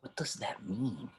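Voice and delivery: unvoiced whisper; hushed question to his partner. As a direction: whisper it, unnerved. Aside in the stranger's hut.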